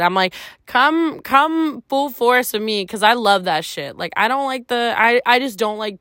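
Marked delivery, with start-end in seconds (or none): 0.7-2.8 high, drawn-out eager invitation; mock-bold, slow and high; eager come-on. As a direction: stretch it out, daring him on. Quoting her own flirty reply.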